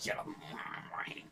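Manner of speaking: slow, very soft unvoiced croak; overdone drunken-scientist rasp; exaggerated Rick. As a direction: drag it out almost without voice. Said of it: "I love exaggerating".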